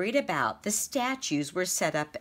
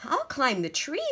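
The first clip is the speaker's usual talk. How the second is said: a touch higher, light mouse voice; a small mouse with a plan; lightly voiced eager mouse; Mortimer the mouse; 8 semitones higher than usual. A narrator's reading. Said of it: "said Mortimer".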